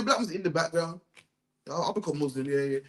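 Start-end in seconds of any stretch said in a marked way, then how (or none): 1.7-2.8 low, soft, agreeable mumble; mock compliance. Preacher voicing a man anyone can convert, mock compliant. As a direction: play someone giving in easily.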